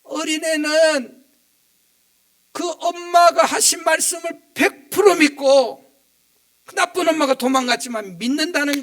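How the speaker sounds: loud, impassioned sermon peak; full-force preaching. Real, not acted. Pastor urging the church to trust God, loud and impassioned. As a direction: drive the point home to the congregation.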